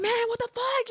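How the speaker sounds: high mock road-rage outburst. Telling her brother about road rage, high mock outburst. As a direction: fake a sudden burst of driver anger. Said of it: "I was like".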